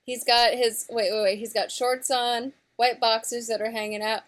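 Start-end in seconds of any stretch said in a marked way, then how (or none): none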